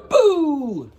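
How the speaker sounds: loud, high boo; a playful scare shout. Acted. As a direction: jump out and yell boo. Monster scares his friend.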